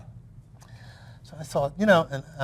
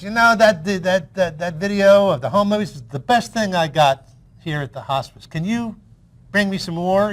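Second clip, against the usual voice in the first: put-on higher, lisping old columnist on the phone; Art Buchwald impression; 6 semitones higher, 6 dB louder than usual. Dying columnist phoning the storyteller, higher lisping voice. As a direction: lightly lisp it like a frail old newspaperman. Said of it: "kind of a lisp".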